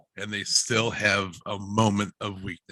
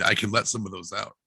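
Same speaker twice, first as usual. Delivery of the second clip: ordinary delivery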